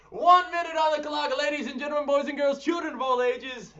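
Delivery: high, loud, fast ringmaster call; announcer voice. Acted. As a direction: announce it like a circus host.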